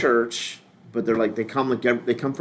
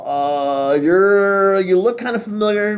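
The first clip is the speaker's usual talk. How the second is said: drawn-out, higher-pitched searching; mock stalling; 5 semitones higher, 4 dB louder, slower than usual. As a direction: stretch the words while fishing for a name. Acts out stalling over a forgotten name.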